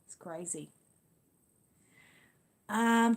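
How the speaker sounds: usual speaking voice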